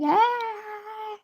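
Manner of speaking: high-pitched mock scream, held; imitated scream. Retelling being hit by a car, mock scream. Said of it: "I started screaming".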